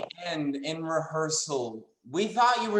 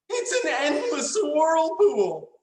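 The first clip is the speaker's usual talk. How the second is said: melodramatic awe; 8 semitones higher than usual. Acted. Melodramatic acted line.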